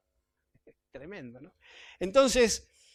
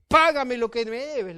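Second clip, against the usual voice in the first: exigencia fuerte y alta, a viva voz; demanda enojada; 4 semitones higher, 9 dB louder than usual. Acted. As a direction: reclama el pago a gritos, como un acreedor furioso. Siervo exige el pago con violencia. (exigencia enojada en voz fuerte)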